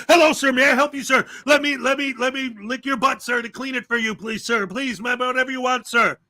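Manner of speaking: mock servile. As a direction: grovel like a fawning cop, hammed up. Mock cop fawning over cameraman.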